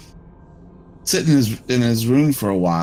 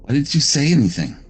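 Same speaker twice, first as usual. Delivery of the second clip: upset accusing; 5 semitones higher than usual. Acted. Upset confrontation at hotel door.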